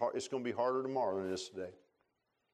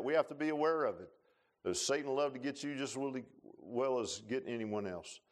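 ordinary talk, nothing marked

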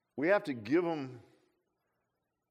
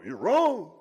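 loud preacher's rebuke, pitched up; John Hagee impression; 5 semitones higher, 10 dB louder than usual. Preacher mocking a TV evangelist, loud rebuke. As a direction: bark it like a fiery TV preacher. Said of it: "Let me give you my John Hagee impression".

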